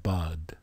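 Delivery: soft, low "Bad." with an open Ah; joined syllable. Shown as a demonstration. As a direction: put the letters together into "Bad.". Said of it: "two letters together with an Ah".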